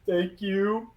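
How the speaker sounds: high, loud stone golem thanks; big weepy golem gulping out thanks. Acted. Golem thanking the party for the handkerchief, high and loud.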